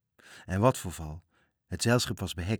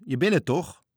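calling out; 7 semitones higher, 7 dB louder than usual. A narrator's reading. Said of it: "riepen de vijf matrozen".

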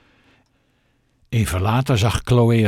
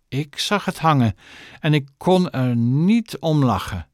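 deliberate weight on "Ik" and "niet"; slow and emphatic; 5 semitones higher than usual. A narrator's reading. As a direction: lean hard on "Ik", then on "niet". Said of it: "traag en nadrukkelijk". (traag, met nadruk op "Ik" en "niet")

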